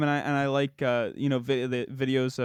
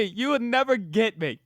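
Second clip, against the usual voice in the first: mock emo whine; 7 semitones higher than usual. Friend mocks the quoted line.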